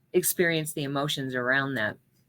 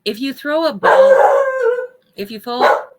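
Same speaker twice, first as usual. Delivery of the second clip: talking louder over barking; a preacher raising her voice over a barking dog; raised over noise; 12 semitones higher, 10 dB louder than usual. Real, not acted. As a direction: push the words over the racket. Dog noise interrupts sermon.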